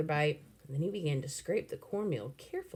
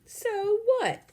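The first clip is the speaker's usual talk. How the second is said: put-on high defiant kid; 6 dB louder than usual. A narrator's reading.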